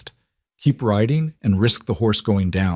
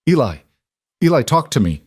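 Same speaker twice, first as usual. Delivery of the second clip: anxious calling; 5 semitones higher, 4 dB louder, faster than usual. Acted. Father calling to fading son.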